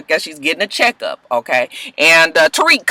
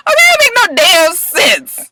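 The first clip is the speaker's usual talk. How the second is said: exasperated; 12 semitones higher, 5 dB louder than usual. Real, not acted. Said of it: "I'm thinking to myself".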